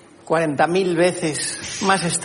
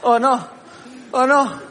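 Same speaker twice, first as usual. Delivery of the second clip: mock panic; 6 semitones higher than usual. Acted panic fleeing bear.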